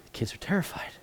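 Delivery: whispered, dropping to a hush. A narrator's reading. Rabbi describing scared kids to class, hushed whisper. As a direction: let the fright hang in a whisper. Storyteller hushes after the yell.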